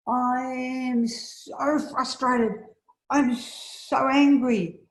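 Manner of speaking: high, slow, drawn-out anger in someone else's voice; a friend's frustration, voiced high and dragged out; frustrated anger. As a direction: act out Meg's pent-up fury. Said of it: "can you hear the frustration?".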